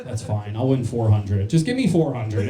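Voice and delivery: ordinary talk, nothing marked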